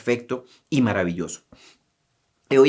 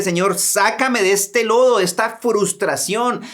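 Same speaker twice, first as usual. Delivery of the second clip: crying out to God; 7 semitones higher than usual. Acted. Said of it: "Es tiempo de gritar y decirle".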